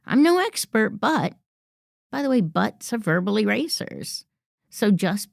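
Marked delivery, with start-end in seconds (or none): 0.1-1.4 higher, timid "I'm no expert, but"; hedging self-doubt. Shown as a demonstration. Coach mimics a nervous colleague, timid and higher. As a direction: undercut yourself nervously. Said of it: "without having to say".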